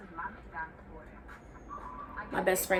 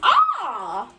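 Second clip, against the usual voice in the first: put-on higher-pitched teenage reaction, loud drawn-out "ah"; mock teenage dismay, pitched up; sixteen-year-old self reacting; 6 semitones higher, 4 dB louder, much slower than usual. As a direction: act out your younger self taking in bad news. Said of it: "sixteen-year-old me reading this".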